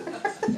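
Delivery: high-pitched giggle trailing on; real laugh. Real, not acted. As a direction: crack up at your own story. Laughing at her own story.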